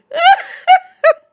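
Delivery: high, loud laugh breaking into sobs; laughing while crying. Real, not acted. Streamer laughs at herself while crying, high and loud. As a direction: let the laugh crack into tears.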